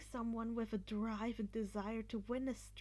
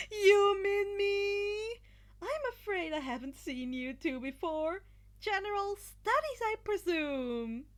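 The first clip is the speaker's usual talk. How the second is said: amused Monoma; 9 semitones higher, 9 dB louder than usual. Acted. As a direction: toy with the strangers. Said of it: "the blonde asked, amused".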